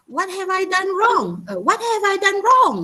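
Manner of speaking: whole question pitched high, "What have I done wrong?" as a real question; puzzled question tune; high rising intonation. Shown as a demonstration. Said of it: "Is that A or B?".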